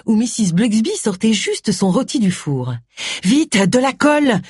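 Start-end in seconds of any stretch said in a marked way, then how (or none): 3.2-4.4 urgent, slowed, higher gasping plea; breathless. Acted. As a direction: gasp it out in a hurry. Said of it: "d'une voix haletante".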